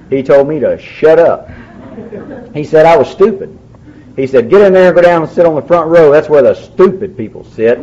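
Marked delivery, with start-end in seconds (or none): 4.5-7.8 gruff sponsor. Retold or imitated. Sponsor Butch scolding him.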